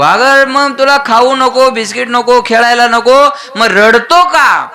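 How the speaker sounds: high, drawn-out mock scolding; exasperated mother. Mother to child who refuses everything, exasperated high voice.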